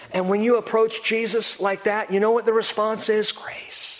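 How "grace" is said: a breathy whisper for one word; hushed reveal of grace. Acted. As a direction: let the answer fall to a whisper. Sermon climax, single word answer.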